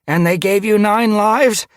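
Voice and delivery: high, loud, doubtful question; worried questioning. A narrator's reading. Mallownose challenges Ice Star before the clan, worried. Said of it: "gaze glittered with worry".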